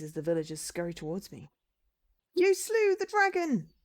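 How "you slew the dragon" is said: high, loud, drawn-out villager cry; cheering villager. Acted. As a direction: hail the hero at full pitch. Villagers hail the returning hero.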